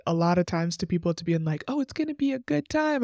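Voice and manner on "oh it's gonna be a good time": high, sing-song naive cheer, sending it up; mock cheerful promise. Mocking how people sell following Jesus, high breezy cheer. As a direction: parody an overly rosy pitch.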